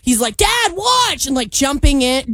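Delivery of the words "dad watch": put-on high, childish call of "Dad, watch!"; imitating a kid. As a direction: shout it like a kid showing off. Kid calling to his dad.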